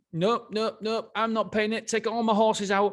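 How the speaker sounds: hammed-up stubborn tycoon turning down a trainer; curt refusal. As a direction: snap each nope like a boss who won't budge. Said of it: "And he was like".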